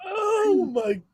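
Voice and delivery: high, drawn-out exasperated groan; mad. Real, not acted. Fan fed up with the team, slow mad lament. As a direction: stretch it out slowly in exasperation. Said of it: "I am mad".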